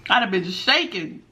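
ordinary delivery

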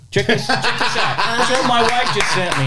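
playful retold excitement; imitated eager coworker. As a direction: eagerly show a buddy something, half laughing. Imitates excited coworker showing phone.

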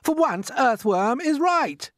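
high, loud, slow centipede voice; crying out. A narrator's reading. Centipede backs the earthworm before the others, crying out. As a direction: exclaim it in alarmed agreement. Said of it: "cried the centipede".

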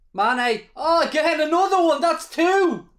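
rising high with excitement; excited commentary. Real, not acted. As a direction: call the chance excitedly. Live match reaction.

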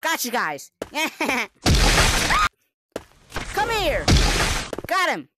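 loud gleeful calls; excited shouting. Real, not acted. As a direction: shout triumphantly while chasing. Chasing, triumphant calls.

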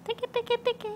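high, singsong nursery chant; high sing-song baby talk. Acted.

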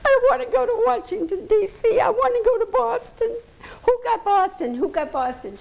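imitating a frantic girl's high-pitched wail; tearful outburst. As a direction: act out someone bursting into tears, high-pitched. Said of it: "She burst into tears, and she said".

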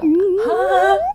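high, audible intake of breath; swooning gasp. Pretend. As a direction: gasp as if swept away. Gasp at the romantic image.

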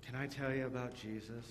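bored, dull ask. Shown as a demonstration. Pastor showing children a boring invitation. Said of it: "Do you think anybody would want to come".

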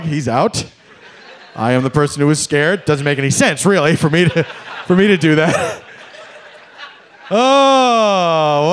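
normal talking voice

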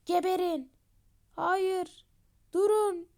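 rising yelled commands in a fight; battle shouts. Acted. A fighter yelling at the enemy mid-battle, loud. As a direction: shout them down as the battle turns.

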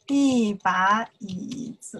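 slow, careful teacher demo; slow model reading. Shown as a demonstration. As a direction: spell the phrase out slowly for learners. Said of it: "Then you know why I use the".

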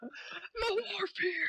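high, slow fake crying; drawn-out mock weeping; mock sobbing. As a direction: wail it like a hammed-up sob.

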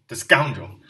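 a sudden loud bitter exclamation; young man cursing to himself, suddenly loud; bitter outburst. Acted. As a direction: spit the word out loudly. Bitter outburst in his musings.

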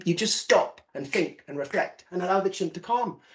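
usual speaking voice

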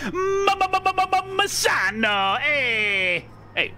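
high, hammed-up Italian stutter into Misano; mock Italian accent. Shown as a demonstration. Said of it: "You gotta say it in an Italian accent".

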